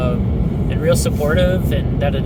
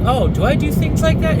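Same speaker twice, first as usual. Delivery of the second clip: surprised; 12 semitones higher than usual. Retold or imitated. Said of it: "and you're like".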